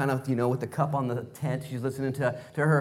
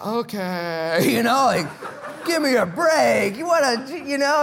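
higher, louder, laughing protest; exasperated Abraham; 8 semitones higher, 5 dB louder, slower than usual. Retold or imitated. Preacher voicing a worn-out Abraham to Sarah, high and laughing. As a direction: Abe pleading give me a break, half laughing. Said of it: "And poor Abe's like".

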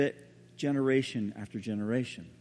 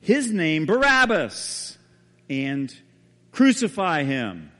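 fragments of crowd shouts; 6 dB louder, slower than usual. Retold or imitated. Only fragments reach Barabbas in his cell, broken loud shouts. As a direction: call out the fragments like a distant mob. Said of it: "what Barabbas heard from his prison cell".

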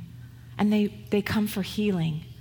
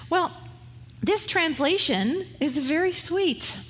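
ordinary delivery

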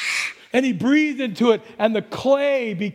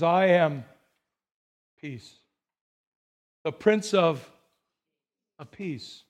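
hushed, sincere Jesus; 6 semitones lower, slower than usual. Acted. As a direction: lay each word down gently, like a promise. Jesus answers, spoken as Jesus.

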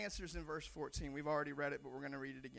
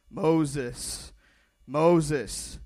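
booming divine call, much louder; God's deep voice calling out Moses; 4 semitones lower, 14 dB louder than usual. Acted. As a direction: call his name like God from the bush. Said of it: "anytime we do the voice of God, it's gotta be real deep".

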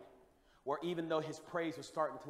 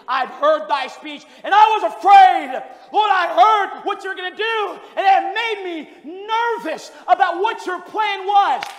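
loud, high, urgent preaching cry; shouted revival preaching; preaching at full force; 11 semitones higher, 20 dB louder, slower than usual. Acted. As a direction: plead with the congregation at full voice. Preaching verse two to seventeen.

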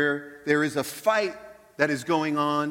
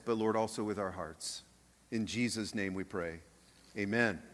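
prayer; 3 semitones lower than usual. Acted. Leads a prayer.

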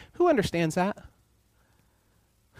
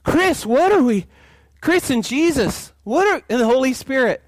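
much higher and louder, flustered; high, loud pretend panic; mock panicked God; 10 semitones higher, 6 dB louder than usual. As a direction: ham up a God caught off guard. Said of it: "God wasn't up and like".

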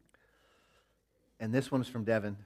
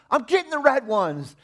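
lightly voiced stubborn child, high and loud; defiant kid; 12 dB louder than usual. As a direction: talk back like a headstrong kid. Son answering back.

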